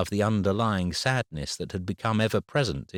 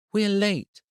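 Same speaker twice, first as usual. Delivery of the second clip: put-on a girl sounding like her mum, much higher; a daughter echoing her mother's voice; 14 semitones higher, 3 dB louder than usual. A narrator's reading. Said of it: "a voice remarkably like her mother's".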